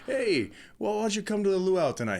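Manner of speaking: lightly acted, high, quick invite; friendly invitation. Retelling a Hawaii movie's luau invitation, lightly imitated friendly local.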